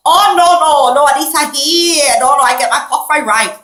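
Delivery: loud, high mock Chinese accent. Shown as a demonstration. Fending off unwanted callers by pretending, in a Chinese accent. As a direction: put on an exaggerated Chinese accent. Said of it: "Let's do the Chinese".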